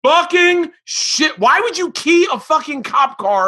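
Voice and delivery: high, loud and slow outraged shout; incredulous roar at a friend's confession; outraged disbelief. Real, not acted. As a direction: yell it in stunned outrage. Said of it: "I am with you".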